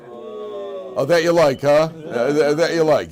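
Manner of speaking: high, loud hammed-up glee; mock delight. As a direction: gush over the prize like a greedy kid. Mock delight at first class.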